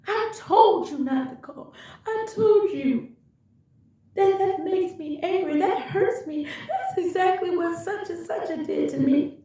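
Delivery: high-pitched furious scream; screaming mad. Retold or imitated. She screams at her partner for calling her a hated name. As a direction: shriek it, hurt and enraged. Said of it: "screaming, mad".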